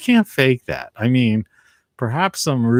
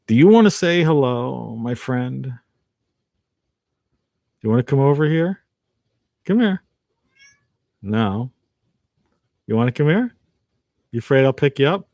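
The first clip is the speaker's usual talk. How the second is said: higher-pitched pet talk with rising questions; coaxing a cat; 5 semitones higher, 4 dB louder than usual. Real, not acted. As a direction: gently invite the cat over, lifting the pitch. Coaxing his cat to come over.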